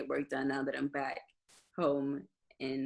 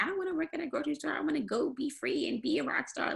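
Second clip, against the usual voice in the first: higher, quicker mock-dreamer voice; mock carefree dreamer; 10 semitones higher, 3 dB quieter, faster than usual. Musician voicing a would-be rock star to interviewer, mock carefree. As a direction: play at longing to be free, pitched up. Voicing an imagined reluctant rocker.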